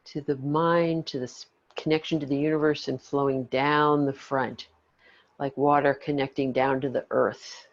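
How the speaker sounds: calm the listener gently. Acted. Earlier guess.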